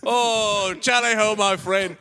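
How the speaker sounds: high, loud tally-ho call; hammed-up British pirate yell; hearty pirate. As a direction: hail a shipmate at full volume. Shouting pirate in improvised sketch.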